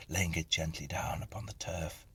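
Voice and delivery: usual speaking voice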